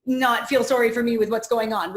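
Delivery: loud, indignant mock rant; mock indignant "how dare you" at the other woman; mock angry outburst. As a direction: act out a frustrated snap back at someone. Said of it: "super frustrated and angry and being like".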